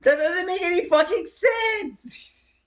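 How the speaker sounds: slow, high outraged whine; exasperated disbelief. Real, not acted. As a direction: drag it out, baffled and furious. Exasperated at Valve design.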